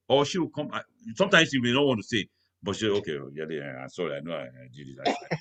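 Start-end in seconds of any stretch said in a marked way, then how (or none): 3.0-3.8 low, quiet, grudging grumble; mock-grumpy wife mumbling lower and softer. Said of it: "that grumpy". 3.8-4.2 lower, grudging I'm sorry; imitating a grumpy wife, low and half-hearted; grumpy apology. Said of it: "that grumpy". 4.2-5.3 low, quiet, grudging grumble; mock-grumpy wife mumbling lower and softer. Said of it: "that grumpy".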